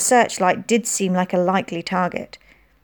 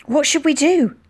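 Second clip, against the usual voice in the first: frightened; 7 semitones higher than usual. A narrator's reading. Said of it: "Terror forced me to speak".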